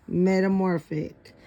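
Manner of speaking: plain reading voice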